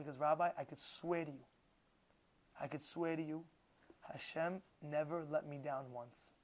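low, soft, understated sincerity; retold tearful confession. Man confides in his rabbi, understated tearful sincerity. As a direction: quietly swear it, slowing at the end. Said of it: "he started crying".

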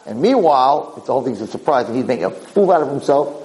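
usual speaking voice

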